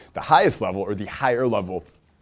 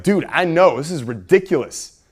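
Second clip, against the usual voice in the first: higher, louder commiserating burst; shared outrage; 7 semitones higher, 6 dB louder than usual. Acted. Friend backing someone who is pissed off, shared outrage. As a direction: jump in on their side, worked up. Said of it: "someone's like".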